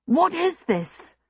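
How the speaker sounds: high-pitched, drawn-out indignant question; panted protest. A narrator's reading. Bewildered prisoner protesting to soldiers marching him, high panted protest. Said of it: "he panted".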